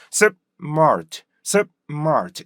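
stopped 습, gap, then mart falling; split in two. Shown as a demonstration. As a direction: cut 습 short, then punch mart. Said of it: "마트가 습에 맞춰서". (습 끊고 mart)

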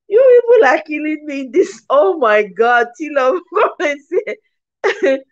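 high voice breaking into laughter; can barely talk for laughing; real laughter. Real, not acted. Cracking up at viewers' comments.

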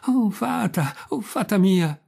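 the puppet calls out in panic; shrieking plea. A narrator's reading. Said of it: "cominciò allora a strillare il burattino".

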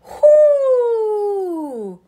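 a loud shaky exhale near tears; emotional breath out; tearful whoo. Real, not acted. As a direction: blow it out, fighting tears.

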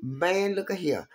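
ordinary talk, nothing marked

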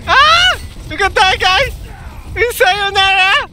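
very high, excited cry; excited taunting yell. Real, not acted. Player telling the enemies they can die, taunting yell. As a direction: crow at the enemy in a high yell.

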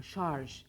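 şarj: end on a clear zh after the r. Shown as a demonstration. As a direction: land the French j in şarj. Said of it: "sessiz harf yan yana".

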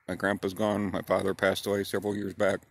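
ordinary talk

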